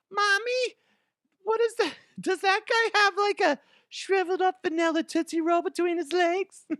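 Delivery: put-on very high squeaky child asking mommy; a little kid pointing and asking. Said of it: "I don't know what voices I'm doing".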